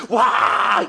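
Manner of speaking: mock outburst of rage, loud "wah"; angry roar. Pastor showing how he doesn't rage at home, mock angry yell. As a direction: burst into a big pretend angry roar. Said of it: "화가 나가".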